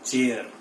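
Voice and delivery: चेयर with final R sounded; common mispronunciation. Shown as a demonstration. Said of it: "हम इसको चेयर बोलते हैं".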